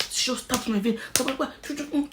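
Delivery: ordinary talk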